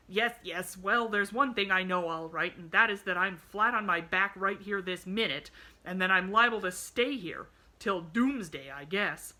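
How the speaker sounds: loud, emphatic grumbling man; cross bedridden man, exasperated. A narrator's reading. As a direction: cut in loudly and complain.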